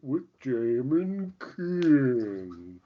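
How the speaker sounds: very slow dopey sloth voice; super slow sleepy sloth. Pretend. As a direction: drag every word like a sloth. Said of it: "impression of a sloth".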